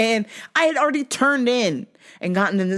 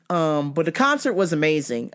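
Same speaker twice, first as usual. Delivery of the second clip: normal talking voice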